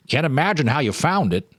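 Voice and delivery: put-on a lightly done old man, wondering; shopkeeper voice. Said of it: "the shopkeeper said".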